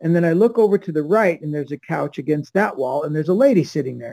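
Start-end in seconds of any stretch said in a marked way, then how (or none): none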